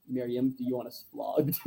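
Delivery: low, soft deadpan mutter; a quiet, dry aside. Retold or imitated. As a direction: lean in and murmur it dryly. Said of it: "And he's just like, leans over".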